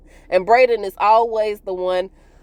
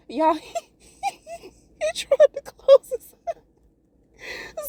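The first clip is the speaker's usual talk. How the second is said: laughing; slower than usual. Real, not acted. Cracking up at the scene.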